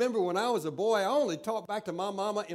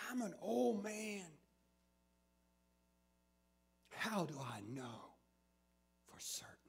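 fading doubt; 10 dB quieter than usual. Acted.

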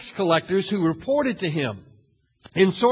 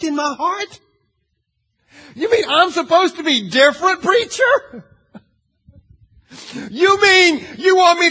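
much higher, louder, whiny incredulous voice; indignant churchgoer; 10 semitones higher, 6 dB louder than usual. Pretend. Churchgoer protesting to the preacher, high and incredulous. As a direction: play a member offended at the preacher's challenge.

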